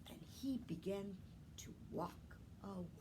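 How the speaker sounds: hushed, let the anger drain away; whispered narration. A narrator's reading. Storyteller to children, whispering as the peddler walks off. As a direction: drop to a whisper as he gives up.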